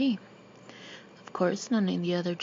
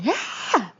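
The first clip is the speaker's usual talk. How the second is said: mock yell, a little higher and louder; scream; 4 semitones higher, 4 dB louder than usual. A narrator's reading. Leslie screaming suddenly at Paul. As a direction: yelp it out of nowhere. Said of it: "Leslie screamed".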